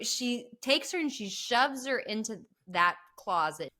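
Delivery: normal talking voice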